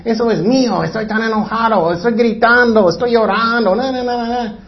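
high mock tantrum; mimicking a whining, demanding child; angry childish adult. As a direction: throw a put-on child's tantrum. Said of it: "Están enojados".

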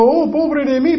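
high, whiny mock lament; mock self-pity. Preacher mocking Esau's self-pity with a high whine. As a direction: whimper it like a self-pitying brother. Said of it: "él era como".

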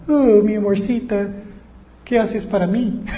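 hammed-up sugary demand; mock sweet talk. Preacher mocking a lover who only takes.

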